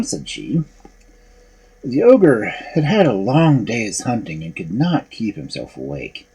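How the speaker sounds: ordinary delivery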